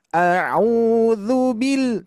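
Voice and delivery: bil ditekan naik lalu turun panjang; stress on bil. Shown as a demonstration. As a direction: tekan suku kata "bil" lalu tahan. (tekanan pada "bil", dibaca pelan)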